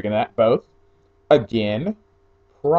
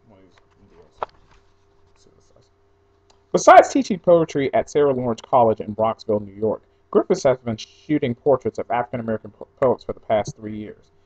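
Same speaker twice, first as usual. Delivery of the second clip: ordinary talk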